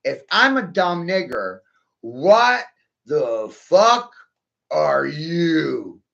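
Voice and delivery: slow and deliberate. Shown as a demonstration. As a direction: pause between the final phrases, punching them. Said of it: "I'll say it slow".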